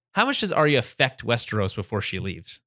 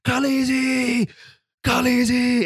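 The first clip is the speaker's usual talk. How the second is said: high, drawn-out mock yell; imitated yelling; 12 semitones higher, 3 dB louder, much slower than usual. Recapper imitates Jorah calling for Dany outside the tower, yelling. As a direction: call out a name, imitating a man yelling. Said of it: "then he starts yelling".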